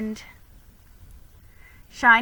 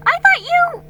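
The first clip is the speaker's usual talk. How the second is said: flustered; 11 dB louder than usual. Acted. Felicity confused and happy.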